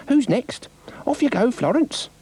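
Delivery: high dog voice; a bossy little dog; Dougal's voice. A narrator's reading. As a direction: Dougal ordering the next runner, high.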